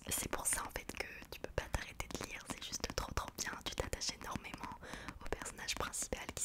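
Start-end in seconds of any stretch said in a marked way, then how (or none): none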